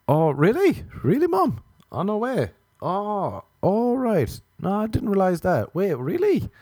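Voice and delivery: high, overdone interested phone chat; pretending to talk to mom at a bar; fake phone call.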